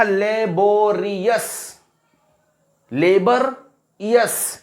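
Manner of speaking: "लेबोरियस" slowly split as ले-बो-रि-यस; broken into syllables. Shown as a demonstration. Teacher counting syllables for class, "लेबोरियस" broken up slowly. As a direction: say "लेबोरियस" in slow, separate pieces. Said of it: "जिनको तोड़कर".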